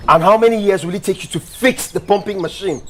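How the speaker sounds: high-pitched, exasperated berating; irate boss demanding answers; angry scolding. Acted. Boss berates worker over water.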